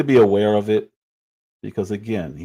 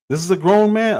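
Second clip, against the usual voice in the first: usual speaking voice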